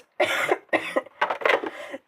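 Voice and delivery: real choking cough; coughing fit. Real, not acted. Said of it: "je m'étouffe".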